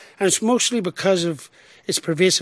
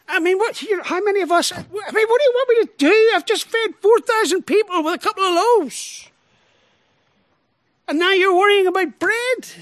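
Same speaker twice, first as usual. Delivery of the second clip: much higher, exasperated, voicing Jesus as he might have spoken; 11 semitones higher, 4 dB louder than usual. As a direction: throw up your hands in mock exasperation.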